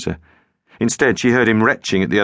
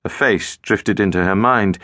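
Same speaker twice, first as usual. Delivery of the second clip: ordinary delivery, nothing marked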